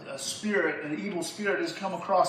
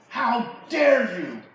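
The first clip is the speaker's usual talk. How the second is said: louder, higher, drawn-out indignation; outraged outburst; 6 semitones higher, 9 dB louder, much slower than usual. Acted. Saul rebuking his son Jonathan, loud outraged outburst. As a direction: let the anger break out on slow words.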